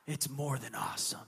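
put-on low, soft awed old man's voice; hushed old man. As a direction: drop low and slow in wonder. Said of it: "And he goes".

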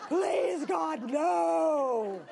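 drawn-out, dramatic begging; acted plea of dread; desperate pleading. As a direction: beg God to spare you, hammed up. Acted silent plea to God.

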